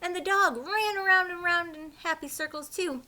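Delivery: usual speaking voice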